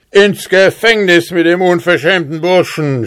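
high, angry captain's yell; furious shout. A narrator's reading. Captain orders the insolent hussar jailed, shouting. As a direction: bark the order in a rage. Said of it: "schrie er".

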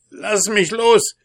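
high, loud dwarf voice, protesting; trapped dwarf crying out to be freed; cursing dwarf. A narrator's reading. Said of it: "fluchte der Zwerg".

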